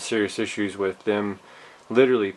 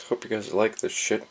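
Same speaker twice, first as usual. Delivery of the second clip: ordinary talk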